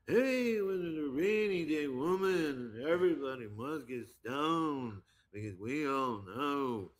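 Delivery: high, drawn-out mock folk-singer voice; half-sung drawl, swooping down from a high start. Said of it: "a mock [name] voice".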